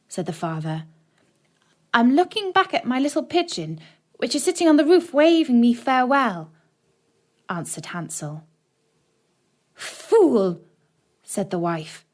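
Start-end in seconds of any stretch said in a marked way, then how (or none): 1.9-6.5 higher, lightly voiced little boy; a small boy making an excuse, lightly; boy's voice. A narrator's reading. Hansel fibs to his father, in a higher, lightly voiced boy's tone. 9.7-10.6 sharp, higher mock scorn; scornful wife. A narrator's reading. Stepmother scolds Hansel on the path, with a higher, scornful snap.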